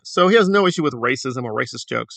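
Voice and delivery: usual speaking voice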